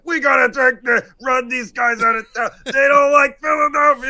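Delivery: very loud, high mock yelling; hammed-up angry mob shout; mock mob outrage. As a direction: holler like an overreacting sports fan. Mocks overreacting angry fan mob.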